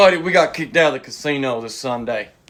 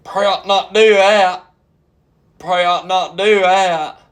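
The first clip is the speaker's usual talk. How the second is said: loud, higher, slow mock scolding; slow, raised-voice caution; loud drawn-out warning; 5 semitones higher, 8 dB louder, slower than usual. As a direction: stretch it out loudly like a warning. Acting out his warning at the bar.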